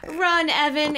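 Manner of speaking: scream. Acted. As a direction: push him to run. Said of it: "Andy screamed".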